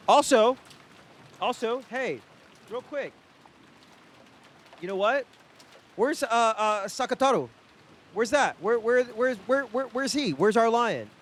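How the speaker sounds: high, eager questions; rapid-fire hunt for the pet; excited. Real, not acted. Streamer suddenly asks chat about the cat, high and eager.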